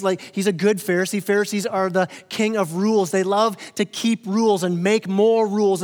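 ordinary talk

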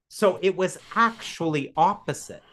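usual speaking voice

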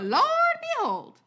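southern accent. Shown as a demonstration. Asking the host to judge his southern accent, hammed-up drawl. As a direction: go big with a Southern drawl, stretching it out. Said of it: "That's my southern accent".

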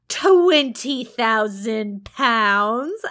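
very slow, loud Twenty thousand pounds, savoured; drawn-out teasing. Acted. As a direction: stretch Twenty thousand pounds out to tease her. St John drawing out sum.

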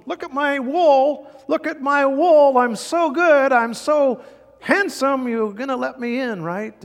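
high, loud, slow preening voice; vain sheep. Acted. Proud sheep boasts to the shepherd, high and preening.

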